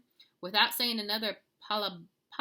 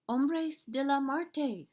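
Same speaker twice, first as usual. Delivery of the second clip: slow, higher, spooky shiver; shivering fear; 8 semitones higher, 5 dB louder, much slower than usual. A narrator's reading. Small dog warning friends, slow shivering fear. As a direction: say it trembling, slowly. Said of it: "with a shiverito".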